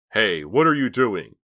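loud, slow shout from the doorway; angry call. A narrator's reading. Cook calling angrily at the girls, a loud shout. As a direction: bark it at the trespassers. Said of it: "a man's voice called to them angrily".

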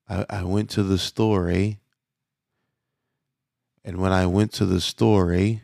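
lightly voiced Canadian, measured and slow with eh; unhurried Canadian accent, tagging eh on each phrase; imitating slow Canadian speech. Preacher imitating his Canadian host, slow Canadian accent. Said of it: "he speaks like this. He speaks very, very, uh, measured and, and slow".

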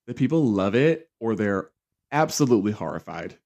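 usual speaking voice

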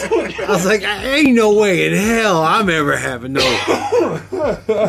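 normal talking voice

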